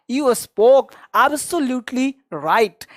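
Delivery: very slow, drawn-out model sentence; slow drill. Shown as a demonstration. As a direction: drag it out for students to repeat. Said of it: "teacher models phrase slowly". (बहुत धीरे बोलकर सिखाना)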